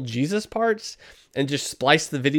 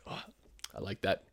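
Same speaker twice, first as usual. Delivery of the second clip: whispered aside; 5 semitones lower, 13 dB quieter than usual. Real, not acted. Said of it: "Ooh, it's a Tim talk".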